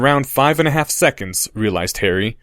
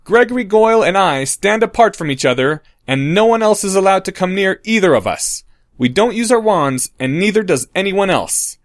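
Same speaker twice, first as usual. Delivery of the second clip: announcing; 8 semitones higher than usual. Acted. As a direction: lay out the rules for everyone to hear. Harry announcing rules to crowd.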